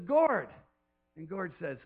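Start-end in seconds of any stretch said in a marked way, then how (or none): 0.1-0.5 higher, louder call. Retold or imitated. God calling Gord, higher and louder. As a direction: call the name like a shepherd. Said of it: "He says".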